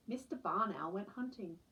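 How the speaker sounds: ordinary delivery, nothing marked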